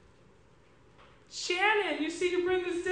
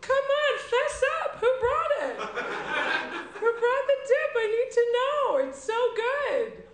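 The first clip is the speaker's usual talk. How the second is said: pushy demand; 6 semitones higher, 5 dB louder than usual. Acted. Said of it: "this first character".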